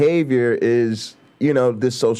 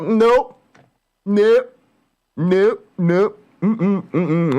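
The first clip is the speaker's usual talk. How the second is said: emphatic refusal; 8 semitones higher, much slower than usual. Real, not acted. Flat refusal repeated.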